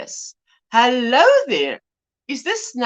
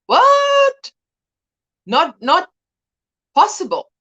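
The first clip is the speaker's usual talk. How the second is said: a sudden high, loud "What?" then a stunned "not possible"; shocked disbelief; 6 semitones higher, 4 dB louder, slower than usual. Retold or imitated. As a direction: react as if you can't believe your ears. Shocked at misheard comment.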